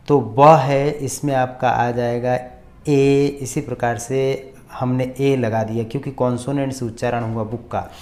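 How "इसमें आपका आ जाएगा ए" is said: normal talking voice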